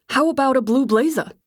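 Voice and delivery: lightly voiced New Jersey barman pitching a drink; light New Jersey bartender accent. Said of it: "New Jersey accent was straight out of The Sopranos".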